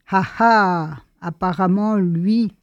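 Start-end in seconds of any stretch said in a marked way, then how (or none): none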